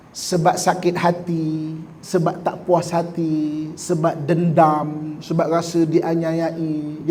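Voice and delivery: slow, deliberate list; drawn-out list of grievances. Acted. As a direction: lay out each reason slowly. Listing bad reasons for crying.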